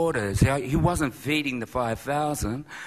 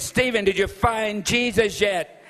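put-on Irish accent; 8 semitones higher than usual. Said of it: "He was speaking in an Irish accent".

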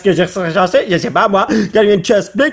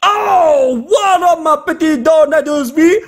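excited greeting; 6 semitones higher, slower than usual. Real, not acted. Greets a rare card.

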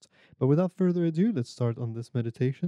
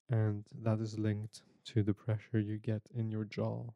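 guided meditation. Acted.

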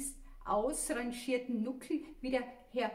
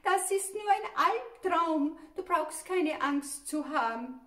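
laut und gedehnt gerufen; müder Ruf; 5 semitones higher, 7 dB louder than usual. A narrator's reading. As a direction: ruf müde aus dem Bett hinüber. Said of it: "öffnete nur müde die Augen und rief". (lauter, gedehnter Ruf ins Nebenzimmer)

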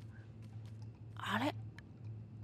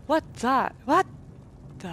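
ordinary talk, nothing marked

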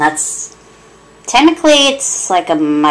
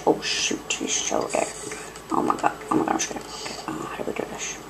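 hushed, frightened whispering; scared whisper; 2 semitones higher, 8 dB quieter than usual. Real, not acted. Sneaking past an enemy, a scared hushed whisper. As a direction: whisper it as if the enemy could hear.

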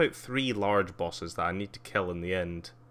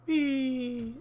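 very high, drawn-out whee; playful whee. Real, not acted. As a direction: squeal a long, piping whee. Playful cry while flying in game.

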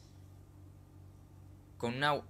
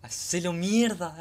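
slow, loud, high cheer; hype cheer; 6 semitones higher, 7 dB louder, slower than usual. Real, not acted. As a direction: cheer him on like a fan. Cheering on anime hero.

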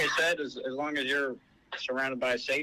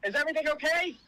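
high, urgent question; real alarm; 10 semitones higher, faster than usual. Real, not acted. Officer checking on screaming caller, real alarm. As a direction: raise the voice in sudden concern.

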